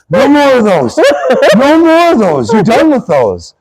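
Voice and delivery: imitating a fed-up opponent; put-on voice pleading for it to stop, rising then dropping. Hurt wrestler banning the backfist, imitated exasperation. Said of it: "That sounds so much like him".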